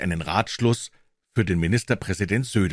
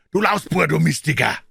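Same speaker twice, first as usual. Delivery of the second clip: high, loud Bavarian cursing; irate Munich porter's outburst; angry yell; 7 semitones higher, 5 dB louder than usual. A narrator's reading. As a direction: yell insults in a sudden flare of temper. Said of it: "schrie er".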